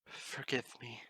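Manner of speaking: breathy, voiceless whisper; whispered plea. Acted. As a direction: barely breathe the apology. Princess's last soft plea.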